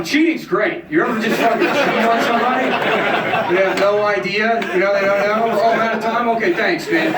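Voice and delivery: high, loud mock-smug comic voice; hack open-mic comic. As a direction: ham up a clueless comic confessing mid-set. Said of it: "Oh, this was new".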